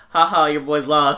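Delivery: loud, slow gloating taunt; mocking taunt. Ex taunting him over the loss, loud and slow. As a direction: rub the loss in his face. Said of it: "they were like".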